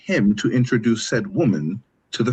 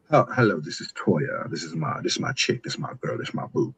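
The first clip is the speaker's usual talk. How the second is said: put-on low, soft, laid-back intro of his girl; casual boyfriend introduction; 7 semitones lower, 6 dB quieter than usual. Man casually introducing his girlfriend to friends, low and soft. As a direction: drop low and easy, like showing off your girl to the crew.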